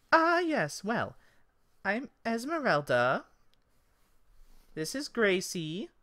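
high-pitched elf introductions; high elf hostess; Esmeralda the elf. Acted. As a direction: present yourself in a high, lilting voice. Elf introduces herself and friend.

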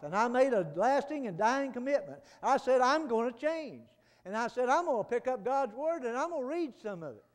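loud, high fervent testimony; preaching at full force. Acted.

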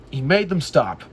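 usual speaking voice

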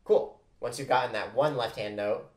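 ordinary delivery

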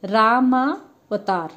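very slow, spelling out Ramavatar; slow teaching demo. Shown as a demonstration. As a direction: stretch Ramavatar out for the class. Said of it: "ऐसे ही". (रामावतार को धीरे-धीरे खींचकर बोलना)

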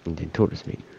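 ordinary talk, nothing marked

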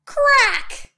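loud, high-pitched crack; sharp crack. A narrator's reading. Narrator voicing the floor splitting, high loud crack. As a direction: shout the crack as a sound effect.

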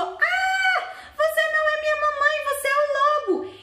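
high, fast, loud frightened cry; the kid spots the big teeth and screams at the wolf; scared shout. Acted. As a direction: scream it as the wolf's teeth show. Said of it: "deu um grito".

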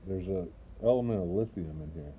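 talking around pipe stem; words through clenched teeth; pipe in mouth. Real, not acted. Seen 2: Pipe stem held between teeth while speaking in every labelled frame.

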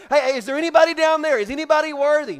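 loud, high and fast calling down below. Acted. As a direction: holler quickly into the depths. Angel calling under the earth.

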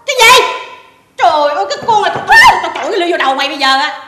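shrieked indignant scolding, high and loud; scandalised, full-voice telling-off; outraged shout. Acted. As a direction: explode at an absurd accusation. Outraged at pregnancy accusation.

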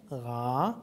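Ra with a long, held vowel. Shown as a demonstration. Teacher showing the next long syllable, Ra held long. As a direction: draw out the A in Ra. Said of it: "then long".

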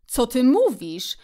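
astonished exclamation. A narrator's reading. Szczur astonished that Kret never sat in a boat, astonished exclamation. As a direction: exclaim it open-mouthed. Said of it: "wykrzyknął szczur z rozdziawionym pyszczkiem".

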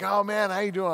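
lightly mocking cheerful missionary voice; chipper missionary. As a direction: imitate an upbeat missionary jogging up. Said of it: "a chipper missionary".

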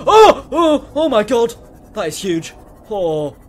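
very high, loud gasping cries, easing slightly; terrified shock. Real, not acted. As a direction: yelp in real fright at a monster. Sudden sight of the Reaper.

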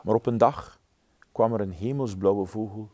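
ordinary delivery, nothing marked

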